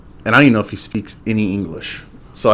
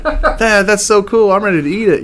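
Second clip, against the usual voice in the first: lightly voiced kid, pitched high; imitating excited daughter; 9 semitones higher than usual. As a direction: squeal it like an eager kid.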